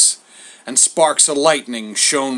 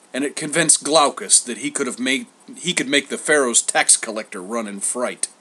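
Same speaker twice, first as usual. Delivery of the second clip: usual speaking voice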